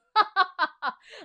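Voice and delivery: high loud villain cackle; evil laugh. Acted. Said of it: "laughed Doctor Diaper".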